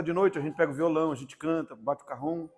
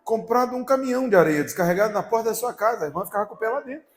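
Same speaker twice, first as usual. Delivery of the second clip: mocking retort; 5 semitones higher, 6 dB louder than usual. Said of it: "Eu falei".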